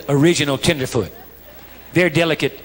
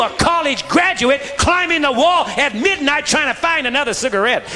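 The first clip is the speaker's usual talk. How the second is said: higher-pitched, driving sermon delivery; forceful preaching; 7 semitones higher than usual. Real, not acted. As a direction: preach it hard, pressing the point on the congregation.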